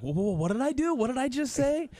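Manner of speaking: high-pitched mock panic; comic startled questioning; alarmed second thoughts. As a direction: voice climbing as if catching your own mistake.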